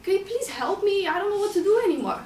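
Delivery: loud, high and fast, begging; desperate plea. Retold or imitated. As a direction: yell for help, near breaking. Begging founder for help in panic.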